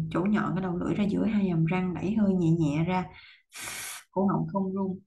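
ordinary talk, nothing marked

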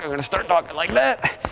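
high, tight voice pushed out mid-exercise; real effort voice while twisting; strained core voice. Real, not acted. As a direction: talk through clenched abs. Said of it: "here's my talk".

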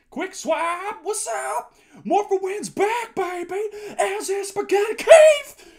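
high, loud roll call that builds; hyped shouting. Real, not acted. Streamer greeting arriving viewers by name, hyped shouting. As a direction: holler each name like a hype man.

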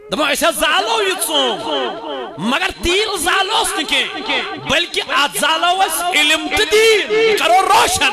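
fiery sermon recital; preaching at full force. Acted. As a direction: proclaim the lines to rouse the crowd. Rousing Urdu verse recital.

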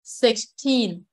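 put-on Sixteen with the S clearly sounded before TEEN; six-TEEN, S distinct and second syllable stressed. Shown as a demonstration. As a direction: articulate the S inside Sixteen. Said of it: "Để ý S".

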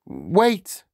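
high, rising schoolboy plea; panicked pupil stalling; flustered cry. A narrator's reading. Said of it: "he cried".

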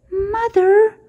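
frightened high squeak. A narrator's reading. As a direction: call out like a lost little bat. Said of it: "Stella Luna squeaked".

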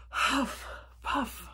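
two puffs of effort; acted huffing and puffing. A narrator's reading. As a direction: puff out each word as if hauling a load. Squirrel strains pulling the heavy wagon.

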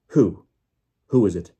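very loud demand; shouted. A narrator's reading. Official demanding the captive's identity, shouting. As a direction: shout the question out insistently. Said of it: "shouted Mister Crouch".

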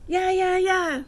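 backing chorus of moons; moons yelling. Acted. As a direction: cheer him on like a gang. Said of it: "The moons yell".

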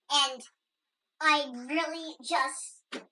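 ordinary talk, nothing marked

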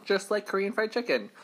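usual speaking voice

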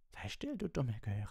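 high, slow snarl of rage; shouted outburst. A narrator's reading. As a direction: cut the pupil off angrily in a high voice. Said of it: "schrie Snape".

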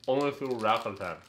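talking through a mouthful; mouth full. Real, not acted. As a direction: say it while chewing candy. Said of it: "Can you not talk with your mouth full?".

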